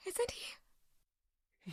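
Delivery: breathless whispered plea; voiceless, desperate whisper. Acted. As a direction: barely breathe the question. Girl repeats the question, breaking.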